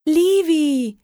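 high, loud cry of the name; girl spotting her kitten on the wall, calling out; joyful call. A narrator's reading. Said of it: "rief ich".

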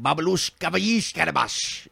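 lightly voiced mock Polish gibberish; fake Polish gibberish. Grandfather teasing kids, mock Polish gibberish. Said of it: "speak gibberish and say it was Polish".

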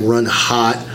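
ordinary talk

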